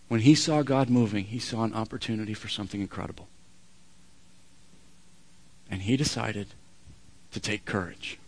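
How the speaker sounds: low, soft, measured preaching; quiet weight with long pauses; solemn hush. Real, not acted. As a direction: bring it right down, almost confidential. Preacher drops to hushed point.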